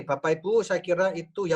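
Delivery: usual speaking voice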